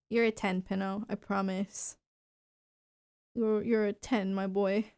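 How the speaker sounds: soft, low doting murmur; softly reassuring a pet dog; tender to dog. Real, not acted. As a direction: coo to the dog curled up on you.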